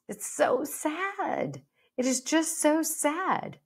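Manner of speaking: high, slow, drawn-out sorry tone; pitying lament. Real, not acted. Coach lamenting catastrophic diet thinking, high slow pity. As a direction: stretch it out in high-pitched sympathy. Laments the dieting thoughts just voiced.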